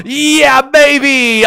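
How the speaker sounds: high, loud drawn-out celebration; triumphant cheer. Real, not acted. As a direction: whoop it out long and high.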